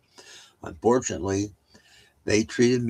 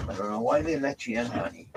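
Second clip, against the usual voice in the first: quick, fond pet talk with a bit of a put-on voice; talking to the dog; 4 semitones higher, 5 dB quieter than usual. Real, not acted. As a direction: coax the dog like you're gently scolding it.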